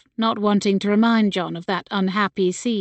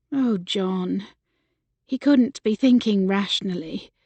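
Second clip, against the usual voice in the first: ordinary delivery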